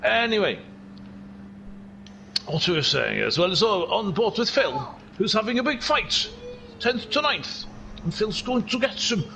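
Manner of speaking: chatty Welsh race commentary; Welsh accent. Pretend. Commentator describing Phil's fight, Welsh accent. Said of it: "this is an accent I've been doing".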